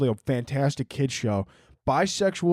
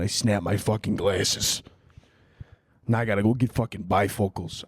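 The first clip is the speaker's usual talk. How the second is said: put-on New York accent; 5 semitones lower than usual. Podcaster showing off how he says glasses, New York accent. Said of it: "a New York accent".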